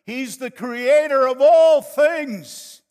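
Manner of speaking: normal talking voice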